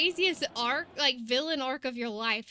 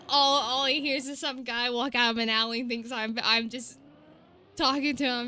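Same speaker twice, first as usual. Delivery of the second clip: ordinary delivery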